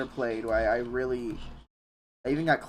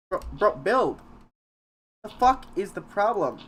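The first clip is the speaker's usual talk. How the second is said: louder, higher exasperated yell; frustrated outburst; 7 semitones higher, 4 dB louder than usual. Real, not acted. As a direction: snap at the game in annoyance. Frustrated at game, raised voice.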